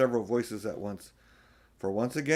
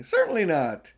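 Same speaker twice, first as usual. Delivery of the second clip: high, loud, fast and insistent; stern proud declaration, slowing at the end; 13 semitones higher, 7 dB louder than usual. A narrator's reading. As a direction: lecture them firmly on the king. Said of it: "said Mister Beaver sternly".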